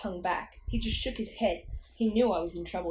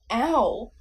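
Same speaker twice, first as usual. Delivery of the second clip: loud pained yelp; 2 semitones higher, 8 dB louder than usual. Acted. Boy touching his tender nose, pained yelp.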